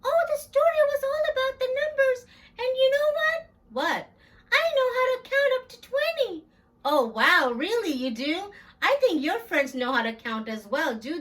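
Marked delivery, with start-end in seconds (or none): 0.0-3.5 much higher, quicker little puppet voice; squeaky kid puppet chirping hello. Acted. Puppet Jackson greeting Miss Big, high and quick. 4.5-6.4 much higher, quicker little puppet voice; squeaky kid puppet chirping hello. Acted. Puppet Jackson greeting Miss Big, high and quick.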